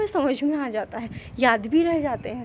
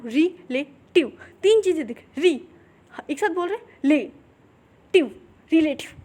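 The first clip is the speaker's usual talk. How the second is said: syllable by syllable. Shown as a demonstration. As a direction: break relative into री, ले, टिव. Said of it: "जैसे relative".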